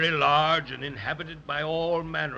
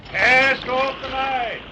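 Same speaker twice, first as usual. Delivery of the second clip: slow, high, loud sailor's holler; calling out an order; 10 semitones higher, 8 dB louder, slower than usual. Acted. Captain to crew at departure, calling out. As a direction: stretch it out across the water.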